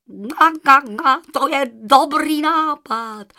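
loud, high goose honks, words drawn out; honking goose. A narrator's reading. Goose agrees to give a feather, honking. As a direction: honk it out slowly like a goose.

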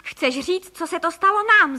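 exclaiming. A narrator's reading. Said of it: "zvolal pan Frejka". (rozhořčeně zvolat)

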